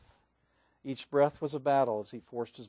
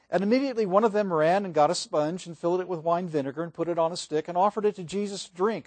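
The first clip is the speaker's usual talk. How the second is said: normal talking voice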